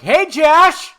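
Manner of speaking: loud high call. Retold or imitated. Rolling down the car window to greet Josh, loud call.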